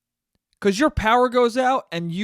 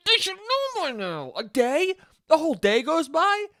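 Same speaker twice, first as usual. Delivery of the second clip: very high mock-incredulous complaint; exasperated outrage; 10 semitones higher than usual. Customer still without power a day later, exasperated outrage. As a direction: squeal it in hammed-up disbelief.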